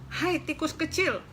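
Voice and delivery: high, scolding hen voice; angry hen. Acted. Brooding hen scolding the mouse, high and cross. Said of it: "kata si ayam marah-marah".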